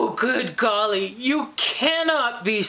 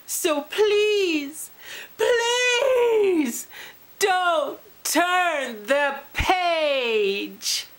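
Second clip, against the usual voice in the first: desperate plea; 6 semitones higher, much slower than usual. Acted. Final plea before last page.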